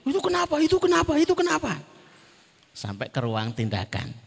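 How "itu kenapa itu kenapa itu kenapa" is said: high-pitched puzzled bystanders asking over and over; people in the room asking. Retold or imitated. Waiting room hears the crying child, much higher worried asking. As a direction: mimic the whole waiting room wondering aloud. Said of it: "seisi ruangan jadi".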